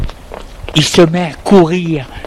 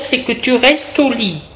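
higher, voiced character line; voix d'épouse bienveillante; 8 semitones higher than usual. A narrator's reading. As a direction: voice the carer telling her patient. Woman voiced, soothing a sick man. (voix aiguë de femme qui soigne)

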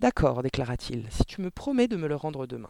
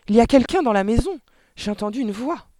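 raised, frightened voice; alarmed exclamation; 8 semitones higher, 9 dB louder than usual. A narrator's reading. Mother warning her husband someone is inside, alarmed cry. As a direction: cry out the alarm, high and loud. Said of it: "s'écria".

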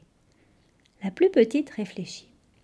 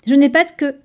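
louder, higher declaration; announcing; 4 semitones higher, 7 dB louder than usual. A narrator's reading. Little one checks her behind, announces the finding. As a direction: announce your discovery out loud. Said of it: "annonça-t-elle".

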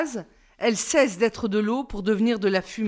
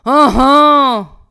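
very loud, forced "ah ah"; hammed-up, drawn-out fake laugh; mock laugh; 3 semitones higher, 12 dB louder than usual. A narrator's reading. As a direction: throw out a sarcastic laugh. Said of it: "fit-il".

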